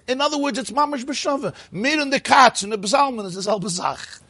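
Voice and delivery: ordinary talk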